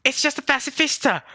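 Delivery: high, urgent shout; Luffy. Retold or imitated. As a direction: yell a frantic warning in a high voice. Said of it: "Luffy's like".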